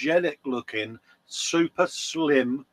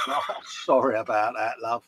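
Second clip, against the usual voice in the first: usual speaking voice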